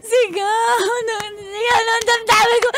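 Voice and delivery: put-on high sobbing drunk plea. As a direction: cry it out, tipsy and heartbroken. Drunk friend sobbing over ex.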